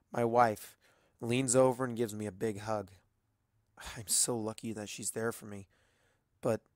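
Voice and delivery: ordinary delivery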